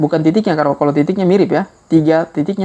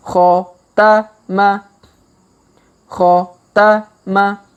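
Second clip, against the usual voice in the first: Khotama drawn out very slowly, each syllable long; slow reading; 3 semitones higher, much slower than usual. Shown as a demonstration. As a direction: stretch every syllable of Khotama. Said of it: "kita baca pelan-pelan".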